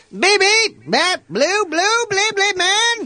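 very high, squealing gibberish impersonation; Chris Tucker. Acted. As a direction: squeak nonsense syllables at a high pitch. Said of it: "my impression of Chris Tucker".

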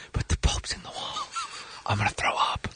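hushed whisper, almost no voice; whispered panic. Retold or imitated. A tripping comic whispering in panic to John Stamos. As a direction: breathe it out like you're about to be sick.

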